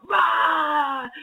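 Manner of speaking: high-pitched playful roar; imitated growl. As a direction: roar like a pretend wild animal. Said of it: "growl in her face loud and hard".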